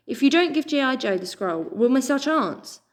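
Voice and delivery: ordinary delivery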